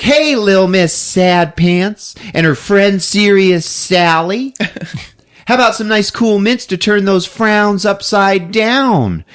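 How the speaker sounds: high, drawn-out chirpy greeting; hammed-up cheerful hospital volunteer; perky candy striper. Teenage candy striper cheering up sad patients, perky.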